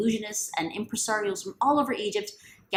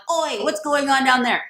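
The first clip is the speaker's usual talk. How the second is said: loud, higher, slow shout from above; bossy shout; 7 semitones higher, 9 dB louder than usual. Acted. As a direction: bellow down at the crowd. Pharaoh shouting from VIP section.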